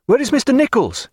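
high, urgent question; crying out. A narrator's reading. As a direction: call it out pitched high. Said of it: "cried Babington again, leaping ashore".

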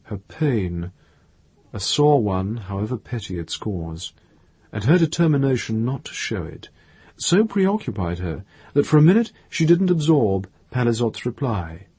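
usual speaking voice

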